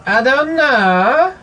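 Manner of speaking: put-on drawn-out Borat accent, rising at the end; Borat-style accent. Echoing a friend's riff in a Borat accent.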